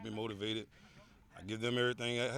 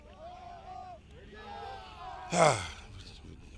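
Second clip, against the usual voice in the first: heavy sigh. Real, not acted. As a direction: let out a big breath before answering. Coach sighs before answering forecast question.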